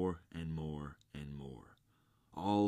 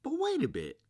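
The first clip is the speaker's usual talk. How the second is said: very high, tiny creature voice; crying-out little oysters; 7 dB louder, faster than usual. A narrator's reading. Oysters beg the walrus to wait, high-pitched cry. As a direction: squeak it out in protest. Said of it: "the oysters cried".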